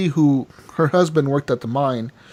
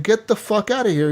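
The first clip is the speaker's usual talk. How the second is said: retold angry order, pitched up; mock angry dismissal; 7 semitones higher than usual. [name] repeating his order to Strauss, mock angry.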